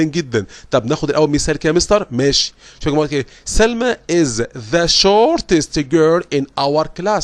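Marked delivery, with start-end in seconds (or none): 3.4-7.2 slow, careful example read; slow English reading voice. Acted. Teacher reading the superlative example to students. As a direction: read it slowly, word by word.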